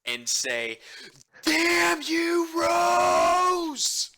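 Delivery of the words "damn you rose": high, loud bard shout; booming yell. Acted. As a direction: bellow it across the city. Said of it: "yell at the top of my lungs".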